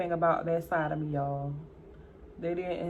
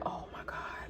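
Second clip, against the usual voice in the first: hushed, under the breath; whispered dread; 13 dB quieter than usual. Retold or imitated. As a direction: whisper it to yourself in dismay. Retold dread, dropped to a whisper.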